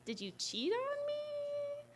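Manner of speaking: soft, slow, pitch soaring very high; incredulous question. Retold or imitated. Girlfriend asking boyfriend about cheating, comically incredulous. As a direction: stretch it out into a high squeak of disbelief.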